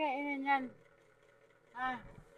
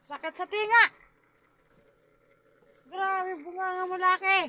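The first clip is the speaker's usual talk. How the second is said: loud, higher yelps of pain; pained cry; 6 semitones higher, 9 dB louder than usual. Real, not acted. Baby biting, mother reacts.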